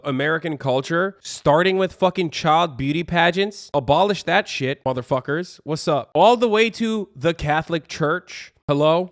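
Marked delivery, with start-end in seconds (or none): none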